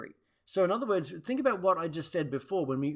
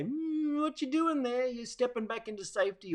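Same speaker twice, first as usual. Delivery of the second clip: gently teasing friend; 9 semitones higher than usual. Retold or imitated. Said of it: "loving mirrors around you to say".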